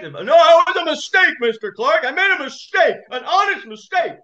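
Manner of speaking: high, loud mock insistence; imitating a shouting judge. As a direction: hammer the excuse like an indignant judge. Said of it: "he was shouting at him".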